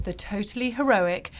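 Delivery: ordinary delivery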